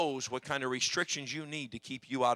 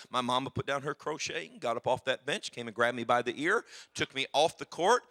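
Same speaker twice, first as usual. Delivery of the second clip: ordinary talk, nothing marked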